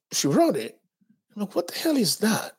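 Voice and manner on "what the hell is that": high, stunned what-the-hell; high shocked disbelief at the slur. Retold or imitated. Reacting to the slur.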